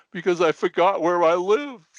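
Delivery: hammed-up old man delivers the punchline. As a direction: land the punchline in the old man's voice. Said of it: "the old man looks at him and says".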